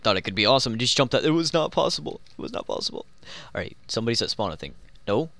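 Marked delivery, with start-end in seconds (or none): 2.4-3.1 low, soft whisper of the same words; low, soft whispered repeat; whispered aside. Pretend. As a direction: mutter it to yourself under your breath.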